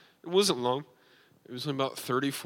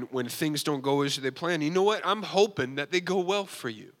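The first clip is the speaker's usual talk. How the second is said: preaching; 4 semitones higher than usual. Real, not acted. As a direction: exhort the congregation with rising fervor. Preaches to the congregation.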